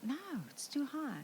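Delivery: whispered, nearly breathless. Retold or imitated. Wife whispering to husband atop the water slide, hushed. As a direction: hush it urgently to your husband. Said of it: "I whispered to Mac".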